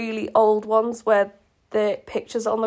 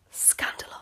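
high gasp then whispered scandalous; hammed-up shock, whispered; mock gasp; 10 semitones higher than usual. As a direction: play scandalised gossip.

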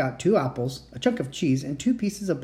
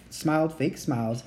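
ordinary delivery, nothing marked

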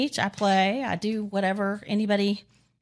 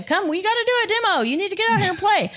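much higher, pestering recruiter; insistent urging; 10 semitones higher than usual. Retold or imitated. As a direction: imitate someone dragging friends along. Retold Pop dragging them along.

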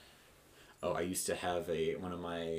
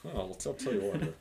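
higher drawled Texan catchphrase; 7 semitones higher than usual. Pretend. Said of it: "[name] starts turning into Hank Hill".

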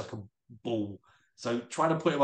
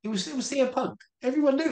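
excited declaration; 14 semitones higher, faster than usual. Shown as a demonstration. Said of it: "Go on, say it".

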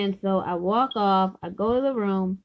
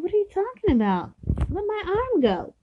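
high-pitched indignant retort, re-enacted; acted protest; 10 semitones higher than usual. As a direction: replay a startled objection, pitched way up. Acted protest to boyfriend grabbing her.

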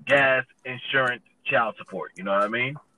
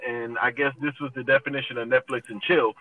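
ordinary delivery

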